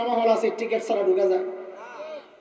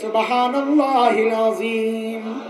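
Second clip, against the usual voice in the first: slow dhikr recitation; much slower than usual. Acted. Preacher recites the dhikr for the crowd to repeat, slow. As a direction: recite it as devotion.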